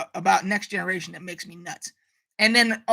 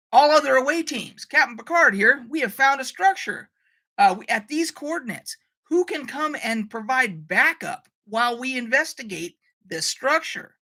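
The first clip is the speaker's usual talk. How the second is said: higher-pitched captain hailing the teams; communicator call; 4 semitones higher than usual. Acted. Captain Picard asking away teams for backup, communicator call. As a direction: put out a call over the communicator. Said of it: "my communicator saying".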